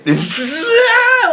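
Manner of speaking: high-pitched burst of laughter; high laughing shriek. Real, not acted. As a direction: crack up with a squealing laugh. Cracks up at the question.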